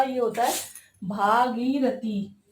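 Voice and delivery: भागीरथी drawn out very slowly, each syllable clear; slow correct form. Shown as a demonstration. Teacher showing students the correct word, very slow careful form. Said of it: "भागीरथी नहीं होता".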